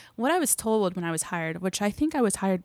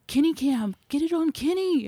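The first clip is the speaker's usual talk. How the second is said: high-pitched retold yell, a little louder; imitated yelling; 8 semitones higher, 3 dB louder than usual. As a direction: imitate a crew member calling out urgently. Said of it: "occasionally someone yelling".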